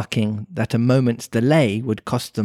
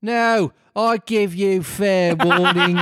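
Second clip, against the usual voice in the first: high, slow Brummie Queen; mock-imperious Birmingham-accented warning; shouting Queen; 8 semitones higher, slower than usual. A narrator's reading. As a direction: warn them in a drawn-out Brummie accent. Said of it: "shouted the Queen".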